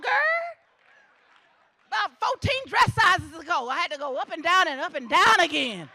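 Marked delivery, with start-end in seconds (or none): 1.9-5.9 rising shout about her past; loud. Real, not acted. Speaker to graduates, joking about her size, loud. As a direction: call it out big to the crowd.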